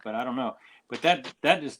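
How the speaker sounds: usual speaking voice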